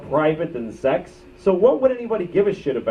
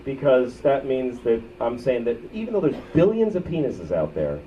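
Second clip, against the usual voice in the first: ordinary talk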